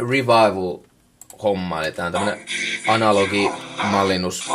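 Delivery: usual speaking voice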